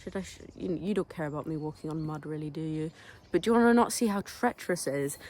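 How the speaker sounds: ordinary talk, nothing marked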